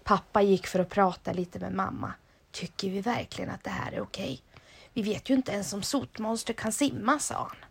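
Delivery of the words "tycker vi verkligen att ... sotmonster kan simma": whisper. Acted. Dad confers privately with mom.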